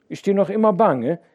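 usual speaking voice

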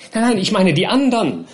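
high, flustered protest; confused. A narrator's reading. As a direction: correct him in confusion. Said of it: "sagte Mister Winkle verwirrt".

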